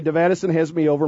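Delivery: usual speaking voice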